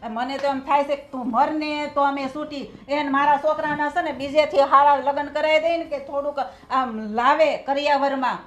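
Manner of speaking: loud, bitter scolding that builds; angry tirade. Acted. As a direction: spit out the curses at her. Mother-in-law curses daughter-in-law. (ગુસ્સાથી ઊંચા અવાજે ઠપકો)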